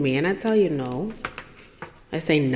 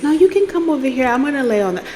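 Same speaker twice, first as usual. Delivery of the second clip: high, hurried insisting; retold polite protest; acted insistence; 8 semitones higher, faster than usual. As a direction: insist in a raised pitch, stumbling over repeats. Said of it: "And he insists".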